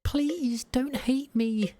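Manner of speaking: high, slow, tearful begging to a ghost; high tearful pleading to Kazuki; pleading woman. Acted. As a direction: beg forgiveness with a high trembling voice. Protagonist begs dead Kazuki.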